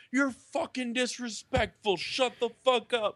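imitating fans yelling, pitched high; mock angry yell. Fans yelling at a rude fan, mock angry shout. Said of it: "people around me that were yelling at him like".

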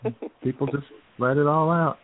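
ordinary talk

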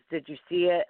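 ordinary talk, nothing marked

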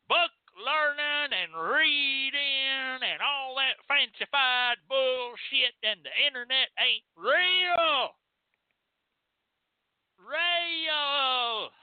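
high, slow, hammed-up backwoods voice; mock the know-nothing country folk, drawn out; hammed-up hillbilly scorn for book learning. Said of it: "And the thing they're saying".

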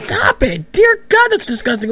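very high, shocked recoil; disgusted shriek. Acted. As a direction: yelp it out in horror.

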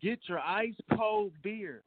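slow, high beer vendor call; stadium vendor hawking. Pretend. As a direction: stretch it out like a stadium hawker.

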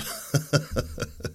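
breathy laugh breaking the bit; amused laugh. Real, not acted. Laughing as his news parody falls apart. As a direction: laugh at his own failed impression.

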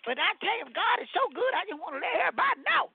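high-pitched, chatty church-lady voice; a high sister fussing cheerfully at the room; Sister Peppercorn. Acted. Greeting the callers as Sister Peppercorn, high and chatty.

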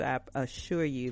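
ordinary delivery

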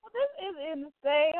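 high, gushing exclamation; thrilled. Retold or imitated. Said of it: "I was like".

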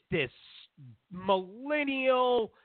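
normal talking voice

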